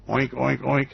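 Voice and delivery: oink it out like a pig. Pretend. Host mocking pork tariffs with a pig oink. Imitating pigs.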